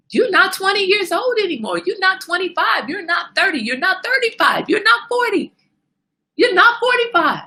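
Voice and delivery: high, loud scolding list of ages; rising scolding. Acted. Scolding herself about age.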